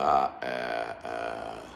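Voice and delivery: very slow low groaning aah; long drawn groan. Acted. Doctor groaning in mock exasperation, slow and low. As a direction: stretch a weary moan out.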